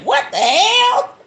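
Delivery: high, loud, drawn-out exclamation; mock outraged disbelief. Mother reacting to daughter loving the burn, mock disbelief. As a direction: let it climb into a comic holler. Retells her reaction to burning.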